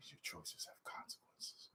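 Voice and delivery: breathy whispered villain line; hushed, menacing movie whisper; whispered impression. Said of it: "talking to Catwoman".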